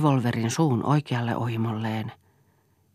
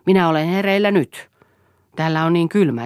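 angry insistence; 6 semitones higher, 6 dB louder than usual. Acted. Quarrel, Eero insists angrily.

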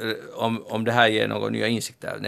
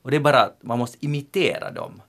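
normal talking voice

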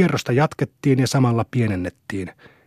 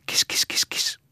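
soft whispered cat call; coaxing whisper; 6 dB quieter, slower than usual. A narrator's reading. Vesa tries to catch the cat, coaxing whisper. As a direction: whisper quietly to lure the cat. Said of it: "oitis hän alkoi pyydellä".

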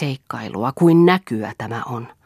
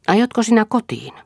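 higher-pitched questioning call, mildly raised; a touch of loud street talk; 8 semitones higher, 4 dB louder than usual. A narrator's reading. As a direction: ask it aloud, pitched well up. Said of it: "Kuului kovaäänistä puhetta".

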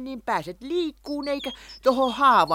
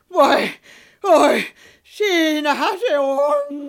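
higher, louder squeals of joy; squealing; 6 semitones higher, 6 dB louder, slower than usual. Acted. Hän löytää taikapussinsa, higher louder joyful squeal. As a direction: squeal as she spots it. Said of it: "Mitä mä kiljun?".

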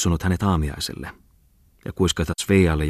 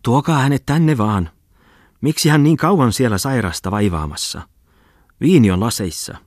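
higher, insistent hostess's call from the hall; calling out after him; 6 semitones higher, 4 dB louder than usual. A narrator's reading. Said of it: "Maissirouva huudahteli eteisestä hänen peräänsä".

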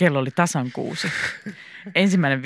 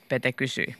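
usual speaking voice

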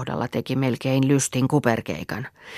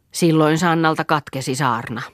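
ordinary delivery, nothing marked

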